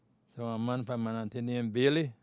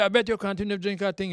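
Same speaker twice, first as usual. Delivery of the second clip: raised quoted voice; 8 semitones higher, 7 dB louder than usual. Retold or imitated. Mister Bailey voiced in retold story.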